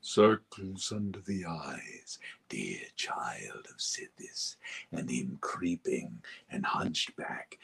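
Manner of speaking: low hissing whisper, slow; creepy whisper. Shown as a demonstration. Voice actor whispering a creepy character. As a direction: whisper like a lurking assassin.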